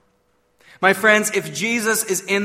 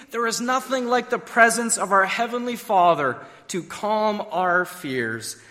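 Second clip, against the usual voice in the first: ordinary talk, nothing marked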